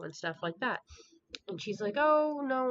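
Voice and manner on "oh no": high, louder drawn-out protest; hammed-up dismayed plea; mock pleading. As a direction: imitate a lover begging, pitched up. Voicing woman begging him to stay.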